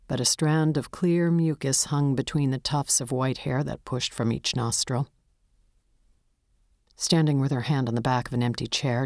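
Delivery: usual speaking voice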